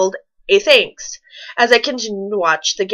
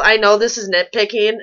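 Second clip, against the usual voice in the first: ordinary delivery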